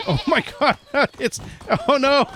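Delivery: high, giggling oh my God; laughing surprise. Real, not acted. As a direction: laugh through it, caught off guard. Sheep crowd round her unexpectedly.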